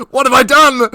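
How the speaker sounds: loud laughing mock dismay; laughing loudly. Real, not acted. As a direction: cry it out through laughter.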